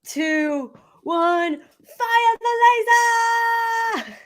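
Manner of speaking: building to a big excited shout; hype shout. Acted. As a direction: yell it out like a launch command. Countdown to firing the laser.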